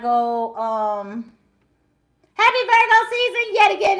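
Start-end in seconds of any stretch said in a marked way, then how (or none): 2.3-4.0 excited greeting. Real, not acted. Festive greeting to Virgo viewers.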